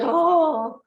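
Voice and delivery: a high, startled little oh; taken-aback oh. Retold or imitated. Lecturer recalling students asking for recordings, startled oh. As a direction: react as if caught off guard. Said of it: "So I was a little bit".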